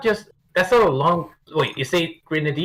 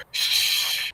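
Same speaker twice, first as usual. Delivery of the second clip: long unvoiced shush; hushing hiss of air; shushing noise; 5 dB quieter than usual. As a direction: let out a sustained "shhh". Voices standing figure going shh.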